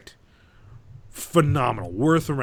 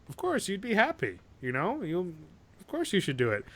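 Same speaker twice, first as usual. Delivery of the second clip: lightly voiced chipper bartender, higher pitched; higher, breezy encouragement as the bartender; 6 semitones higher than usual. Bartender urging the stranded man to do it, breezy. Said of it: "the bartender's like".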